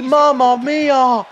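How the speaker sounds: hammed-up NXT commentator exclaiming. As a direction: stretch out the call like an awed ringside announcer. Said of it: "Comme dirait un certain commentateur de N X T".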